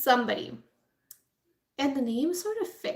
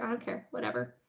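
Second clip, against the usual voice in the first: much lower, softer couldn't-care-less toss-off; dismissive shrug; 12 semitones lower, 9 dB quieter, faster than usual. Retold or imitated. Bork agreeing to fire the prosecutor, low and softly dismissive. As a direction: throw it away like it means nothing. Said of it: "And Bork is like".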